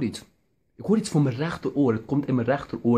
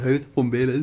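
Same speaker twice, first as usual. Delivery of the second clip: ordinary delivery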